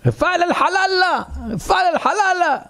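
high shouted call across the house; the rabbi shouts for the barber from the sukkah; calling out loud. Retold or imitated. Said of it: "צועק".

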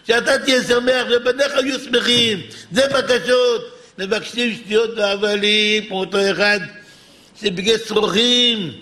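insistent, intoned exhortation; declaimed prayer with singsong endings; fervent preaching. Real, not acted. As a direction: press each plea on the congregation. Preaching at full force.

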